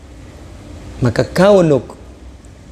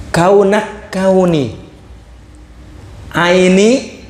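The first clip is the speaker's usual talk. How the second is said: slow recitation; much slower than usual. Shown as a demonstration. Quoting verse again.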